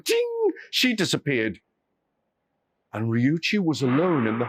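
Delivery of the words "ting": high bright chime sound; a sharp magical ping; mouth-made vanishing chime; magic ting. Acted.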